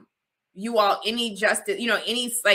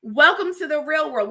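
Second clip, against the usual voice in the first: teasing welcome; 7 semitones higher, 5 dB louder than usual. Retold or imitated. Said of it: "People were like".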